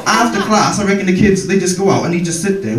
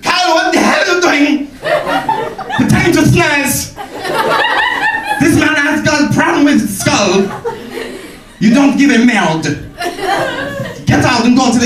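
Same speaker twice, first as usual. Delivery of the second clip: French teacher scolding; 11 semitones higher than usual. Retold or imitated. French teacher shouting at the boy, French-accented rant. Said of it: "she'd be like".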